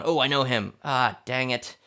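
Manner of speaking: ordinary delivery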